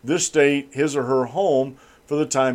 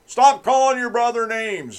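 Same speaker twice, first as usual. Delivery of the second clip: scolding parent; 8 semitones higher than usual. Retold or imitated.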